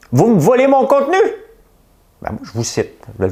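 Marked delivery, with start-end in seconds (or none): 0.1-1.2 shrill mock outrage, pitched way up. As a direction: play the offended media boss crying theft. Imite un patron de média outré. (cri outré imité, voix très aiguë)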